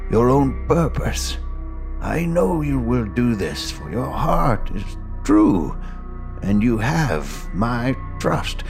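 slow, grave farewell of a mentor; solemn old master. Acted. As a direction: speak each word like a last blessing. Master's farewell speech over music.

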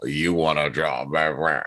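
low, slow fake American drawl; mock American accent. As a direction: parody a Brit attempting an American accent. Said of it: "picked a bunch of British people to be like".

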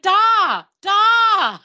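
loud, high acted yell; drawn-out high mock shout; calling for Dad. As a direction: holler high for your father. Acts kid calling out to dad.

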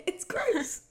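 high, laughing "gross". Real, not acted. Host laughing in disgust at the kiss.

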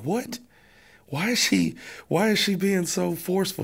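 high, startled what-is-happening voice; stunned disbelief. Retold or imitated. Husband reacting to wife's sudden order, high stunned disbelief. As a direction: sound caught off guard by her firmness.

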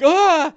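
short, high, loud yelp; startled scream. Acted. Bing being hit by the great axe, screaming. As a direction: cry out as the axe comes down. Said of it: "Bing is like".

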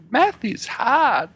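high, slow talking doll voice. Retold or imitated. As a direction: drag it out like a pull-string doll. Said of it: "Barbie says".

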